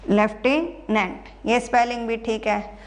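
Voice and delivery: ordinary talk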